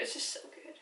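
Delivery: breathless whisper of delight. Real, not acted. Whispering to viewers about her favourite scene. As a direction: whisper it like a happy secret.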